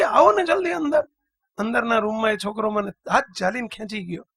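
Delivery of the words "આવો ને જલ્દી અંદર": put-on urgent child. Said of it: "મને કે".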